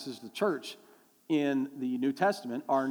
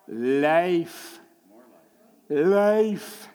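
emphatic call; 5 dB louder than usual. Real, not acted. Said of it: "crowd-style call and answer".

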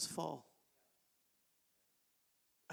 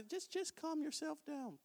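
much softer, higher and slow, soothing tone; calming; 10 semitones higher, 10 dB quieter, slower than usual. Retold or imitated. Pastor telling greedy congregants to calm down, soft mock soothing. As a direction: hush them like settling a fretful child. Calming an agitated money-lover.

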